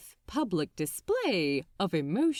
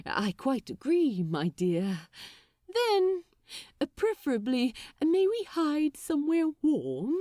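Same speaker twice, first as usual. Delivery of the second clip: a touch higher, prim and polite angel; lightly voiced fussy angel, chilly and hopeful; 5 semitones higher than usual. A narrator's reading. Aziraphale voiced, shivering.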